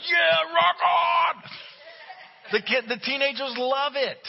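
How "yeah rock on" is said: very high, loud, slow cheering yell; a hammed-up stretched-out rock cheer; mock rocker dad. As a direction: whoop it like a dad blaring rock. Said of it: "see the dads in the back going".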